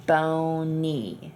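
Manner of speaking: bony with a held n, syllables split. Shown as a demonstration. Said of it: "split that out into syllables".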